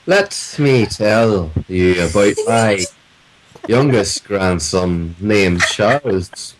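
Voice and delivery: very slow Yorkshire caller with long pauses; antagonizingly slow. Retold or imitated. Yorkshire caller rambling about grandkids, antagonizingly slow. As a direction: drag out every phrase, pausing mid-sentence. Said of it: "so antagonizingly slow".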